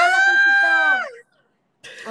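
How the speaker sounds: very high, loud, slow squealed hello; delighted high-pitched shout; excited greeting. Real, not acted. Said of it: "greeting a visitor".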